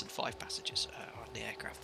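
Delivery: whispered correction; half-whispered aside to yourself; whispering. Real, not acted. Correcting passenger count aside.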